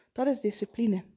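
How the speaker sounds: usual speaking voice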